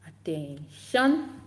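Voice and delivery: drawn-out "attention" rising to a loud last syllable; attention call. Shown as a demonstration. Teacher demonstrates getting kids' attention, drawn-out rising call. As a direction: call the class to order, stretched and punched. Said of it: "have to say".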